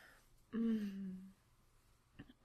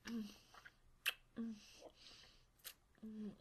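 normal talking voice

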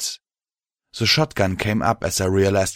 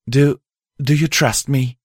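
higher mare voice, stumbling start; halting, tentative question in a raised pitch; hesitant question; 6 semitones higher, 4 dB louder than usual. Acted. Mare asking the host for trust, hesitant. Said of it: "Pride said quietly".

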